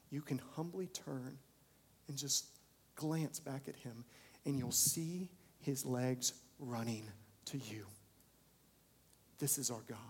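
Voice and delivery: quiet, tender, slowing sermon close; soft closing appeal. Acted. Preacher closing the sermon on God's mercy: hushed tender appeal. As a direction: draw them in gently.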